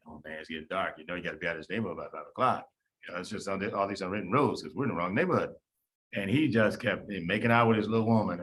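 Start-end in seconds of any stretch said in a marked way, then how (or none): 0.1-5.5 a much lower, softer, hurried retelling of a buddy's nervous warning; hushed warning. Retold or imitated. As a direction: mutter it low like a nervous friend at dusk. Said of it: "he's like".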